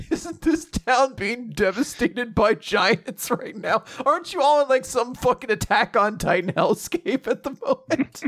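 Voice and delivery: high mock-incredulous questioning; hammed-up bewilderment. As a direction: protest in pretend disbelief, pitched high. Acting out disbelieving onlooker.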